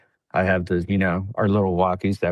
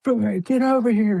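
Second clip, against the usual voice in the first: retold yell; 13 semitones higher than usual. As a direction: bark it like a sergeant under fire. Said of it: "Master Sergeant Humphreys is like".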